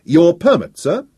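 high, loud demand; loudly. A narrator's reading. Professor demanding the speaker's permit, loudly. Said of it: "equally loudly".

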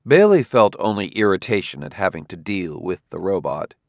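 ordinary delivery

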